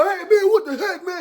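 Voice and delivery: high-pitched heated protest; squawked indignant yell; mock outrage. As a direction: imitate a scuffle breaking out, voice way up. Acts out the angry brawl.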